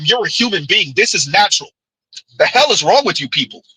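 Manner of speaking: usual speaking voice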